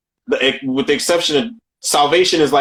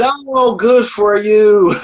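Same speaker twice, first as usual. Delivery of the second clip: mock rant, drawn out and higher; 6 semitones higher, 3 dB louder, slower than usual. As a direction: imitate an actor going off, stretching the words. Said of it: "He was going off. He said".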